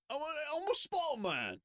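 very high, put-on British voice; mock British Spider-Man. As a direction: squeal it in a silly British accent. Mimics British Spider-Man actor.

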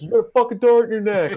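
high-pitched retold yell; imitated movie scream. Friend retelling the tranquilizer dart scene, screaming. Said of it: "and he screams".

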